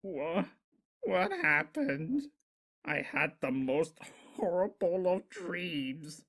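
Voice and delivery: high, slow, groggy confusion; dazed waking voice. Acted. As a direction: come to slowly, bewildered. Discord wakes dazed.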